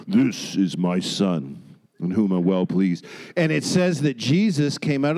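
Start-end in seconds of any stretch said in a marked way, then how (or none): 0.1-3.1 very low, slow voice of God; deep, drawn-out boom from heaven. Acted. Preacher voices God proud of his son, booming low. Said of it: "this distinct booming voice".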